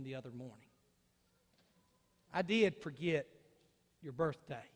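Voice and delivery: a hushed, sincere confession to his wife; soft apology. Pretend. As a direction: say sorry gently, meaning it.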